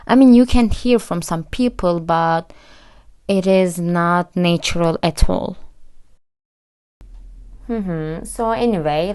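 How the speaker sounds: ordinary talk, nothing marked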